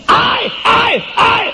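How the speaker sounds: grito fingido. Retold or imitated. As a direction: play the accusing wife crying out in fake distress. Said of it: "Ahí viene". (grito agudo fingido de mujer)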